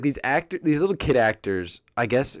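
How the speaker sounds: ordinary talk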